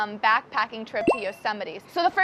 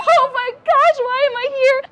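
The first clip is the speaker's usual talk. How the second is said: much higher and louder, a panicked squeal; panicked cry; 13 semitones higher, 9 dB louder than usual. Retold or imitated. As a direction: shriek it like you're terrified in the storm. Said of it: "I'm freaking out".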